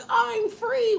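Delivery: high-pitched, airy cry of freedom; carefree exclamation. Acted. Women out in dresses, carefree high-pitched exclamation.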